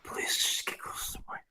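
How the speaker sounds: soft whispered plea; whispering. Real, not acted. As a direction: breathe the request.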